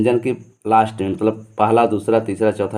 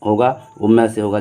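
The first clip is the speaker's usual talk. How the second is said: normal talking voice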